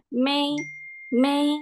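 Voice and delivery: MAY held high and level; first tone. Shown as a demonstration. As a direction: sustain May on one high note. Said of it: "di bagian may-nya aja". (May dengan nada satu, tinggi dan rata)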